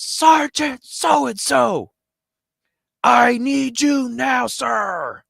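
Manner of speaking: loud, high drawn-out parade-ground holler; military shout. Retold or imitated. Airman at full attention summons his superior, formal military shout. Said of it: "stands up at full attention".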